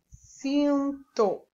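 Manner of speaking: "Cinto" in two drawn-out syllables; sounding out syllables. Shown as a demonstration. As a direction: say "Cinto" slowly as if writing it. Dictating while writing the word. ("Cinto" ditado em duas sílabas)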